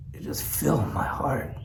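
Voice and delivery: soft whisper, still choked up; tearful whisper. Real, not acted. Telling viewers the call moved him, tearful whisper. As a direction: breathe it out through tears.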